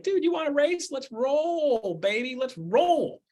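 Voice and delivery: a playful dog inviting a race; cocky dog. Retold or imitated. Dog taunting its chaser to race, high and cocky. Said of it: "That dog's like".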